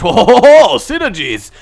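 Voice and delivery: very loud, high hammed-up French accent; over-the-top French rapture, loud and high; bad French accent. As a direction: exclaim in a terrible put-on French accent. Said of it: "that was the worst French accent ever".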